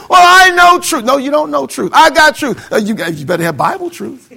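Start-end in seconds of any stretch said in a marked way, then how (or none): 0.1-1.0 higher, louder, smug claim; mock smug believer. Preacher mocking a smug believer, much higher and louder. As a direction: sneer it like a know-it-all churchgoer.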